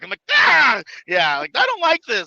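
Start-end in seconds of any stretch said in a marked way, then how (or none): 0.3-0.8 loud high yelp; mock yelp. Retelling the throw with a loud yelp. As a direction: yelp like a rag doll thrown. Being thrown like rag doll.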